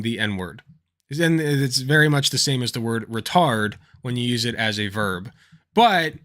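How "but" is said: ordinary delivery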